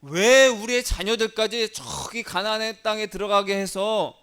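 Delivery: loud, high lament; complaining wail. Acted. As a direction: moan and grumble to Moses. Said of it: "막 원망하고 불평을 합니다".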